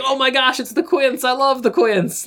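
high-pitched mock delight; hammed-up high excited squeal of recognition; imagined fan gushing. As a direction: gush like a starstruck fan. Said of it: "funny if he was like".

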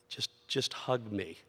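put-on slow and hushed, a child's quiet plea; soft plea. A son quietly asks his lecturing dad for comfort, hushed and slow. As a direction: ask quietly and slowly, almost breaking. Son's plea after confession.

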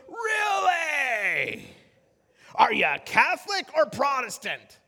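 high, booming, drawn-out question; loud seatmate voice. Retold or imitated. Loud passenger quizzing the preacher beside him, booming. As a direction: blare it out for the whole plane. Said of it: "with that same loud voice".

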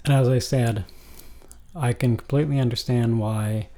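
ordinary talk

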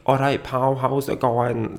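light mock Glaswegian, slow on the end; a quick sketch of a Glaswegian pal; Glaswegian accent. Shown as a demonstration. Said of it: "I'm not gonna do a Glaswegian accent".

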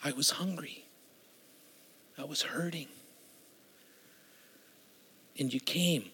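very soft, with long pauses between phrases; hushed quoting. Acted. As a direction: speak Christ's words barely above a breath. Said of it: "quoting Jesus softly".

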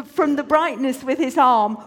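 plain reading voice

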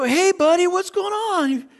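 put-on high-pitched, called-out hello to a kid; cheery greeting. Young coach greets the boy running up to him, high cheery call. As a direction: call out a big friendly greeting, voice way up.

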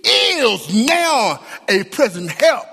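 high, ringing preacher's cry; full-force pulpit preaching; impassioned preaching. Acted. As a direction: lift the congregation with rising fervor. Preaching, building to the I am.

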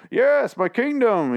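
imitating the actor's grand king voice; Bane voice. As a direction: ham up a medieval king, slowly. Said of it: "He does his Bane thing".

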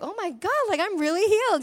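a hint of a wide-eyed child; amazed girl. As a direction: mimic a surprised young girl. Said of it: "she's like".

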